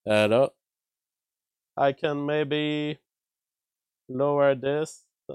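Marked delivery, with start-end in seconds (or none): 0.1-0.5 garbled robot-like mic sound; glitchy robotic voice; robotic audio glitch. Real, not acted. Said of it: "J F is having some robo voice".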